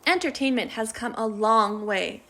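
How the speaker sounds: usual speaking voice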